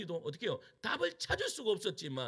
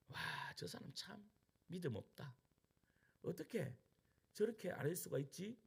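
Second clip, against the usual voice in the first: hushed gossip; 11 dB quieter than usual. Retold or imitated. Onlookers judging quietly.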